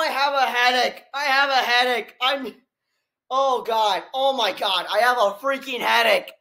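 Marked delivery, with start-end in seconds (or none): none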